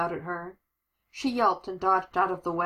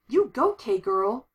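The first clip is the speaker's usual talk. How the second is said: loud, higher, drawn-out cheer; slow, whooping encouragement; 4 semitones higher, 5 dB louder, much slower than usual. Acted. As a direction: cheer a friend's victory, stretching the words. Acted cheering after monster is beaten.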